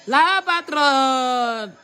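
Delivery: very slow, stretched-out "La patronne"; drawn-out announcement. Acted. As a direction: drag "La patronne" out like a grand entrance. Repeated announcing the boss arriving. (annonce très lente et étirée)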